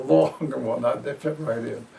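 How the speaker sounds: ordinary delivery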